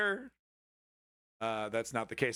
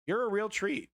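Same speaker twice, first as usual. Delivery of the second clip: sarcastic; 6 semitones higher than usual. Acted. Sarcastic repeat in put-on voice.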